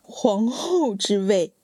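slow, disbelieving repetition; stunned echo. Acted. Wife echoing her sister's words, slow and stunned. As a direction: repeat it slowly in shock.

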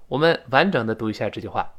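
ordinary talk

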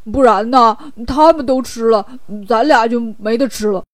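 oafish pig-man character voice; goofy put-on voice, simple and eager; Bajie, greedy and eager. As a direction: urge a companion on, thinking of food. Voicing Bajie eager for food.

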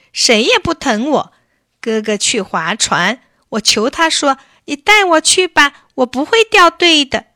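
put-on high, loud boy's protest, rising into pleading; shouting in grievance. A narrator's reading. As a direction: cry out like a wronged child. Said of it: "巴夫利克嚷起来". (委屈地高声嚷嚷)

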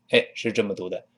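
ordinary talk

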